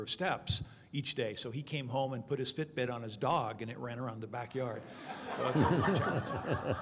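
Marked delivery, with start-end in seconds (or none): 5.4-6.8 laughing. Real, not acted. Amused at dog wearing Fitbit.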